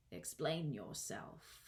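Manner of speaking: low, soft, slow command; stern caterpillar. A narrator's reading. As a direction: order it slowly and quietly, stretching the words. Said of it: "said the caterpillar sternly".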